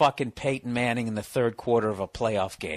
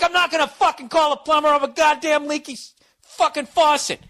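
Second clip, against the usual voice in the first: high, strained full-voice shout; retold furious yelling; 7 dB louder than usual. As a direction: scream the refusal down the phone. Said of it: "I yelled at him so much".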